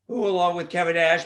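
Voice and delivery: ordinary talk, nothing marked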